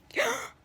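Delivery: sharp theatrical intake of breath; mock gasp. Abigail discovering a ghost, mock gasp. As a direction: gasp as if catching someone out.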